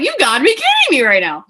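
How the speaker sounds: high, loud reenacted disbelief; incredulous. Seeing the sign she asked for, loudly incredulous. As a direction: exclaim it in mock outrage. Retells shock at rose sign.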